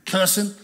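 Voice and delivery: "cussing" said with a short u, as in Guilford County. Shown as a demonstration. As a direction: stress the local word "cussing". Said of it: "cussing is what we call it in Guilford County".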